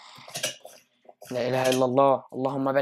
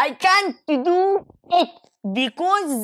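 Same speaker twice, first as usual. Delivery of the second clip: mocking voice; 13 semitones higher, 6 dB louder, much slower than usual. Mocking opponent's English.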